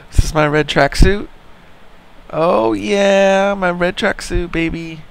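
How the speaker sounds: high, gleeful crowing over a find; delighted. Real, not acted. Gamer finding the red tracksuit at last, delighted. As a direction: show off how thrilled you are.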